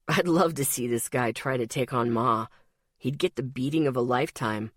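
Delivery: low voice with a chuckle in it; amused boyfriend. A narrator's reading. Ethan chuckling about Ma.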